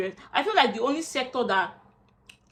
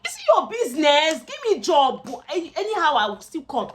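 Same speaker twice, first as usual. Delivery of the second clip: frustrated, raised voice; exasperated; 6 semitones higher, 6 dB louder than usual. Real, not acted. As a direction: push back in frustration, louder and higher. Frustrated reply to employers.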